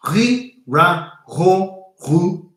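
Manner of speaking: "ri, ra, ro, ru" each with a French R, high and loud; French R drill. Shown as a demonstration. Demonstrating practice for French R's, "ri, ra, ro, ru" with French R's.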